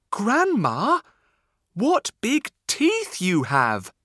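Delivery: put-on high, loud little girl voice. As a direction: ask it wide-eyed like a child.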